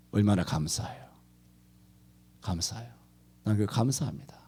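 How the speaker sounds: hushed whisper of gratitude; whispered thanks. Real, not acted. Preacher whispering his thanks aside to the congregation. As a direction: whisper it under your breath.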